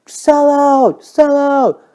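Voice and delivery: loud, high-pitched heckle; crowd yelling. Retold or imitated. Folk crowd jeering the electric singer, yelling. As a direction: imitate a jeering crowd's drawn-up shout. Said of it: "the crowd would be yelling".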